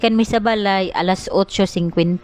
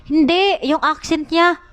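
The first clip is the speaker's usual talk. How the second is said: higher-pitched mimicked teacher correction; imitated teacher; 6 semitones higher than usual. Teacher objecting to a child's accent, mimicked in a higher voice. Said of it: "Mingon ang maestra".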